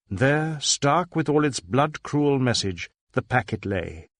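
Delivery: usual speaking voice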